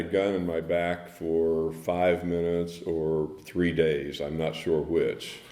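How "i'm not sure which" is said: ordinary talk, nothing marked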